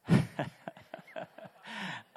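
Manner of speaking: high, rapid burst of laughter; amused high-pitched giggle; real chuckle. Real, not acted. Chuckles after telling his story.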